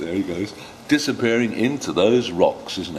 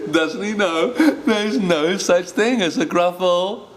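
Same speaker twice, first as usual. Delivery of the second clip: higher teasing mouse; sing-song the mouse's boast; little mouse teasing; 10 semitones higher than usual. Acted. Mouse voiced in story.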